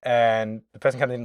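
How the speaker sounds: ordinary talk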